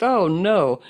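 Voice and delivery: a jokey gasp of dismay; mock alarm. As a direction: feign alarm at the word.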